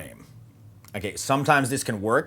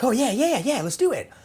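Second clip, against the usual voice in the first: much higher, yapping mock eagerness; eager puppy dog; 14 semitones higher, 3 dB louder than usual. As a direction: gush like an overeager puppy. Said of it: "like some little puppy dog".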